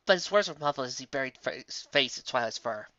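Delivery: plain reading voice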